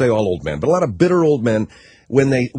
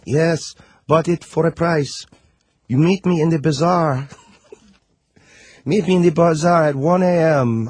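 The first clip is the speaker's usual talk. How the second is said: secretive informant with a hammed-up Middle Eastern accent; mock Middle Eastern accent; 4 semitones higher than usual. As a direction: bargain in a high, fake Middle Eastern accent. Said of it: "I'm doing the accent".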